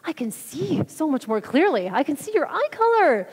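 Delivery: high, drawn-out imitation of wonder; imitating amazement. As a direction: retell it as an astonished, excited exclamation. Retelling a healed woman's amazement.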